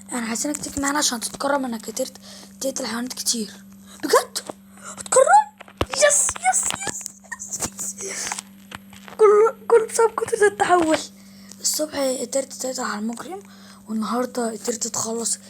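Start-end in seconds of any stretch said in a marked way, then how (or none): 4.0-8.3 high, loud excited squeals; thrilled. Acted. Boy hearing he will be honored, squealing with joy. 9.2-11.0 excited. Acted. Honored for transforming.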